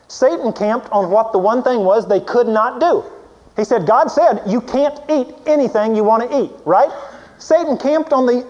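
loud, high, driving sermon voice; preaching at full force. Acted. Preacher building up about Satan going after Eve, loud and high. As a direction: build the point and hammer it home.

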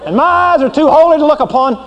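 high, scornful God driving out a sinner; angry holy God. Acted. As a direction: play an offended God ordering a sinner out. Preacher plays God rejecting sinner.

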